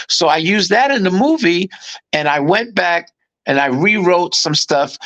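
ordinary talk, nothing marked

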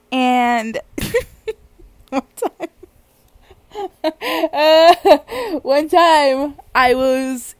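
slow, laughing too hard to start; helpless giggles between drawn-out words; real laughing. Real, not acted. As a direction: keep cracking up as you begin. Cracks up starting the story.

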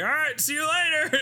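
high, cracked ranting voice; crazy homeless man. Retold or imitated. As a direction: rave like an unhinged drunk. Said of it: "He's like".

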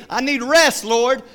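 high, loud plea to God; hammed-up cry for help in prayer; mock pleading. Believer asking the Lord for rest, mock high pleading.

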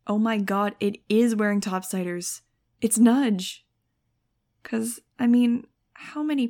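bursting with happy recognition; excited realization. A narrator's reading. Narrator recognizing her friend.

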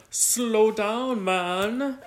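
put-on hollering at another racer in an old-fashioned voice; old-timey driver voice. Acted race driver quote.

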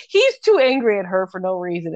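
ordinary talk

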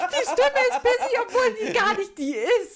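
high and loud, laughing through it. Real, not acted. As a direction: crack up while saying it. Mockingly quotes pissy voice laughing. (lachend, hoch und laut)

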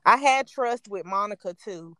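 usual speaking voice

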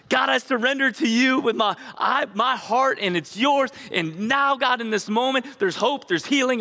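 high, fervent mock worship voice; worship moment prayer. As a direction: lift it up like singing praise in church. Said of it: "we're just like".

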